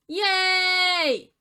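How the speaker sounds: high, loud, put-on cheer; mock cheer. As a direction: squeal a fake celebration. Sarcastic cheer.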